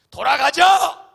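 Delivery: angry crowd shout. Retold or imitated. Grumbling Israelites demand to go back, a loud slow shout. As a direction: yell it as the grumbling people rising up. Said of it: "그러면서 하는 말이".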